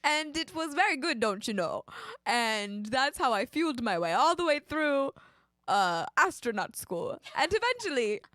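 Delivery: hammed-up Canadian accent, lilting; mock Canadian accent. Would-be astronaut on astronaut school, mock Canadian.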